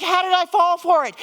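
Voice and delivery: high, exasperated outburst; furious self-reproach. Retold or imitated. Furious at herself over brother's trick, high and exasperated. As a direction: cry out at your own gullibility. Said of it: "And I'm furious!".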